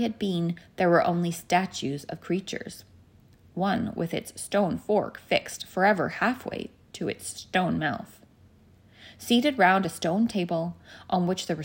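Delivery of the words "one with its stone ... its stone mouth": ordinary delivery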